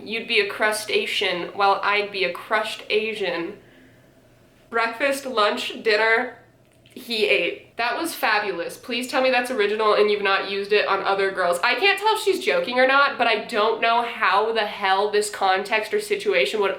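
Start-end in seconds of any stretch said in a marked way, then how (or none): none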